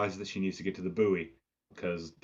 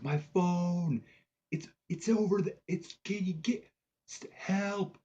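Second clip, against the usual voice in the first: very high, halting imitation of a woman calling out; mock desperate calling; 11 semitones higher, slower than usual. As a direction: mimic calling for help from far away. Stranded woman calling across beach.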